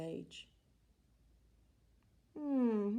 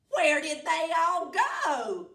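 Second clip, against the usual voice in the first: loud, high witch shout; 6 semitones higher, 10 dB louder than usual. Acted. Witch shouting her question at a duck. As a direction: yell it out across the farm. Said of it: "Gritch shouted to a duck".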